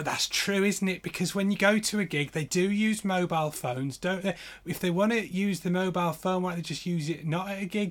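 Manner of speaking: hammed-up wide-eyed agreement; gullible crowd voice. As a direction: parrot the man like an awestruck fan.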